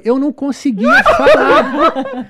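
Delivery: slow, high words through laughter; loud real laughing fit; laughing too hard. Real, not acted. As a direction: barely get it out for laughing. Recalls being unable to speak laughing.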